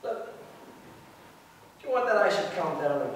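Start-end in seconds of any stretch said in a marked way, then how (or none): none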